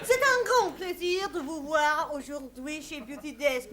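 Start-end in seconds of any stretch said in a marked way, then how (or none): 0.0-1.6 hammed-up French accent on the name; mock French. Host greeting a guest in mock French.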